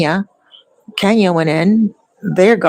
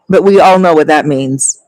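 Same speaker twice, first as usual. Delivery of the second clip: usual speaking voice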